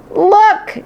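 high excited yell. Acted. Miranda spotting the ghost, high excited yell. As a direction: call out to the class. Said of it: "she yelled".

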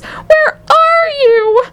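very high, long-drawn wailing scream; piercing, stretched-out cry. A narrator's reading. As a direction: shriek each word slowly for a lost child. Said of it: "screamed Missus T V".

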